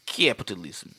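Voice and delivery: capitalism low and drawn out, Russian style; Russian accent. Shown as a demonstration. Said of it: "I can't even not say capitalism like".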